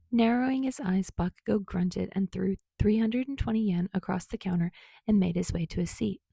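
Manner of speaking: ordinary delivery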